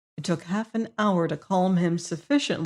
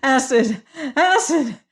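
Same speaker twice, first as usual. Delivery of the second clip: high, louder gasped cries of the word; terrified gasps; 7 semitones higher, 4 dB louder, slower than usual. A narrator's reading. Prisoner repeating the voice's word to the warden, gasping in terror. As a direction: gasp it out in mounting horror. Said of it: "gasped the prisoner".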